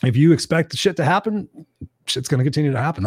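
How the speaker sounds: ordinary delivery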